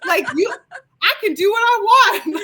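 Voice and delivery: mock defiant. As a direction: snap back like a defiant wife, playfully. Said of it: "When Chris says it, you're like".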